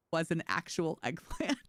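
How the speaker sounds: ordinary talk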